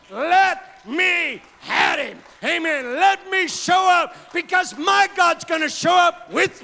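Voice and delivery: loud, rising preacher's call; full-force preaching. Acted. Preacher driving the congregation toward God with full force. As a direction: build the sermon to a roar.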